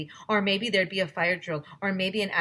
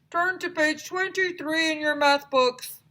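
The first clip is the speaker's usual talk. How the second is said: high, loud puppet voice; 10 semitones higher, 4 dB louder than usual. Acted. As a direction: greet the class as a sock puppet. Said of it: "said Mister Bongo".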